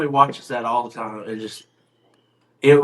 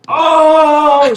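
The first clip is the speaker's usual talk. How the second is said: high, loud mock scream; acted-out grandma shriek; imitated scream; 7 dB louder than usual. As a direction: wail a long "oh" like grandma screaming. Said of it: "She starts screaming".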